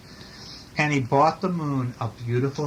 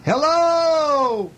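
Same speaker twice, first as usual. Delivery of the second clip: high, loud echo of Bear's call; echoed shout; 13 semitones higher, 6 dB louder than usual. A narrator's reading. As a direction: send the call back as a mountain echo. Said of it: "his own voice echoed off".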